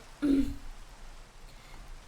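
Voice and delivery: rough throat clear after a drink. Real, not acted. Streamer clears throat after drinking water on stream. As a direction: clear the throat before going on. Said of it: "Ah, my throat".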